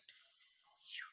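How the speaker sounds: breathy voiceless "psiu"; whispered shush. Shown as a demonstration. Teacher demonstrating the silence interjection, whispered shush. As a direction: hush the room with a whispered "psiu". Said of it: "De silêncio".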